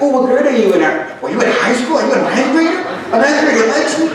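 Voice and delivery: high-pitched, giddy amazement; rising, wide-eyed questions; excited. Retold or imitated. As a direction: re-enact a kid thrilled by an older admirer. Re-enacts thrilled reply to admirer.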